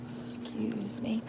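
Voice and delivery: soft breathy whisper in the aisle; polite whisper in a store; whispered excuse me. Real, not acted. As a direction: slip past someone in a whisper. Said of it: "I'm getting aggravated".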